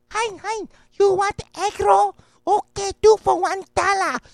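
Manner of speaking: high-pitched put-on Chinese vendor accent; mock Chinese vendor accent. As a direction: hawk egg rolls in a jokey Chinese vendor accent. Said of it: "it got Chinese down".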